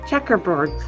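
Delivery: plain reading voice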